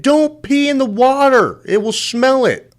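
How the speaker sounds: high, strained call of a guide; mock guide shout. Boat guide yelling a warning to swimmers in the water, high and strained.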